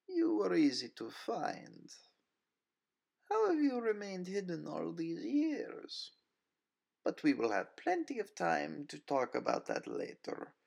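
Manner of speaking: a predator toying with her prey; Nina's cool, mocking voice. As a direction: villainess purring her taunt. Nina voiced as a character.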